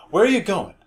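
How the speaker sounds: loud, high-pitched challenge; calling out sharply. Acted. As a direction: call after someone walking away. Acted challenge to listener who leaves.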